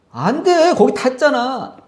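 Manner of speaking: mock wife protesting high; imitating wife. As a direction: play the wife insisting it won't fit. Wife voiced, arguing in car.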